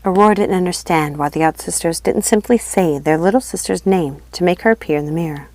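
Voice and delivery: plain reading voice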